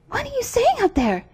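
put-on very high, anxious girl's voice; Gerda crying out. A narrator's reading. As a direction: call up to them in a high, urgent voice. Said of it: "cried Gerda".

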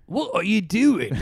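high, slow put-on voice, badly done; Corden impression. Co-host showing off his bad Corden imitation, slow and high. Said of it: "let me do his impression".

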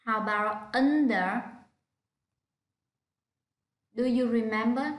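ordinary talk, nothing marked

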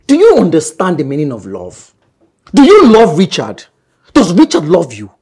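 loud, confrontational questioning; angry challenge. Acted. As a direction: fire each question at them as an accusation. Man angrily challenges her love.

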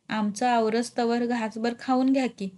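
usual speaking voice

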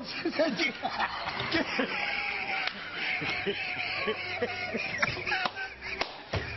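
newsroom blooper. Real, not acted. Anchors crack up on air.

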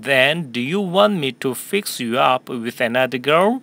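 slow, clear sentence for repeating; teacher modelling a question slowly for students; slow model reading. Shown as a demonstration. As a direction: read it slowly for the class to echo. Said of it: "한 번 더 할까요?".

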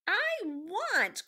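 high, loud wail; long-drawn, high-pitched character cry. A narrator's reading. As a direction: cry it out at the top of the voice. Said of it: "cried Meg".

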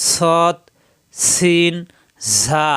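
সৎ, সিন, ঝা each opened with a whistled hiss; whistling sibilants. Shown as a demonstration. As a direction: push breath through সৎ, সিন, ঝা. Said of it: "শীষ দিয়ে উচ্চারণ করতে হয়".

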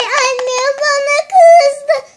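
crying "kızdı" stretched slowly; fake crying. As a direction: wail it out like a tantrum. Same complaint cried first time.

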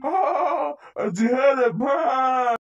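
hammed-up evil laughter, then a yell; mocking laugh ending in a shout; villain laugh. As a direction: laugh him off the stage. Villain laughs as kid flees.

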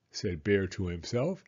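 ordinary delivery

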